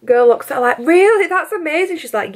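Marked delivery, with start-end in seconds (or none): 0.8-2.0 high, gushing mock awe; retold amazement. Stranger believing the dolphin trainer story, high mock amazement. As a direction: imitate a gullible stranger, pitched way up.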